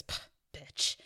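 imitated whispered jab, barely voiced; whispered insult. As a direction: toss the insult out under your breath. Retelling a muttered insult in passing.

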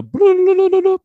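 high, loud trilled noise; imitated slot machine. Comic showing his mind spinning for jokes, high slot-machine noise. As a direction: spin the reels with a high burble. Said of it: "your mind goes like a slot machine".

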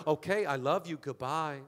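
lightly imitated low sign-off; Pastor Jude. Friend signing off a birthday voicemail, lightly imitated, dropping low. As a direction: drop the voice low to end the call. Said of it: "This is how he talks".

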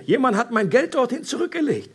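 high-pitched astonished exclamation; storyteller voicing a startled cry; calling out. A narrator's reading. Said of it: "rief er".

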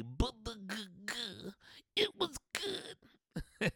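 high, breaking, slow fake-ecstasy cry; mock ecstasy. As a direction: whimper it out like a climax. Mock climax recollection.

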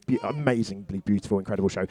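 ordinary talk, nothing marked

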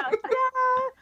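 high, bright showman flourish; excited ta-da. Real, not acted. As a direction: present it like a magic trick. Announcing her helmet surprise.

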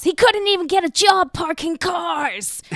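high, strained movie-quote outburst; hammed-up, high-pitched anguished outburst; Rambo. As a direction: cry it out like an aggrieved action hero. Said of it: "I love the Rambo movies".

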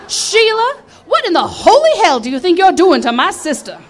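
loud indignant call; mock scolding sister. Sister confronting the drag queen, loud and indignant. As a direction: protective sister telling him off. Sister rescues her angrily.

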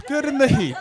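high, coy little turn; cute voice. Pretend. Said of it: "I did a cute turn".

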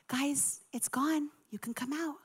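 very soft, gentle call; hushed announcement. Retold or imitated. Desk gal at the bathroom door, hushed. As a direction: imitate a quiet all-clear.

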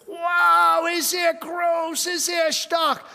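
shouted wonder at the giant's size; awed shout. Retold or imitated. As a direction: bellow it out in awe, like a frightened crowd. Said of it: "haben gesagt".

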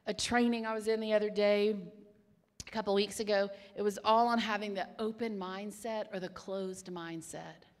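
ordinary talk, nothing marked

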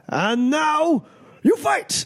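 high, slow, drawn-out proclamation; fight-starting cry. Acted. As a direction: stretch it out, then launch the fight. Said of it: "and he goes".

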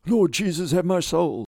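high, desperate final cry; screamed. Acted. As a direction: scream a last prayer as the pilot. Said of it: "Asker screamed once more".

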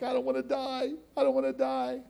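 high, wailing plea; imitated crying. Retelling his fear of dying to the congregation: high mock wail. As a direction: whimper it like a scared child. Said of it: "And I'd cry 'cause I'm like".

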